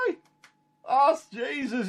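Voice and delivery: high, slow, amazed cry of recognition; wide-eyed, high and drawn-out; excited recognition. Retold or imitated. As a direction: call it out, stunned to see him on the shore. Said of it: "he says".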